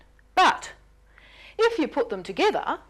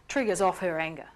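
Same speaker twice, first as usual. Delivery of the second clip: ordinary delivery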